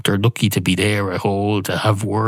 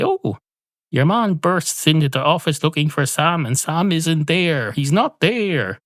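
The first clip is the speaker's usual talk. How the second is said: higher, lightly hammed-up sitcom line; mock script line; 7 semitones higher than usual. Comedian voicing a sitcom script excuse, higher and hammed-up. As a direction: read it out like a cheesy sitcom cover line.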